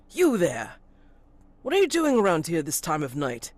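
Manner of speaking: loud barking challenge; barking constable. Acted. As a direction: bark it out like a stern constable on patrol. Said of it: "barking tones of".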